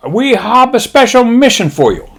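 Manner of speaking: high mock-Japanese admiral voice. Admiral briefing his pilots, high mock-Japanese voice. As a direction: announce it in a put-on Japanese accent. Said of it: "He says".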